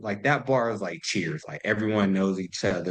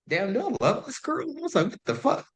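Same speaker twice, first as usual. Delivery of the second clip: pitched way up in shocked disbelief; stunned realization. Retold or imitated. Realizing he loves her, high and stunned. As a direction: squeak it out like it just hit you.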